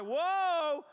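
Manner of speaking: drawn-out high, loud whoa; worship yell. Retold or imitated. Preacher imitating his own worship, loud yelling. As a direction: belt it out like an overexcited worshiper. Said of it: "I'll start yelling, like".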